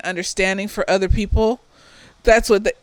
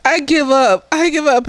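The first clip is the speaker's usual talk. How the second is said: mock defeated whine; 6 semitones higher, 4 dB louder than usual. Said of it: "talking about".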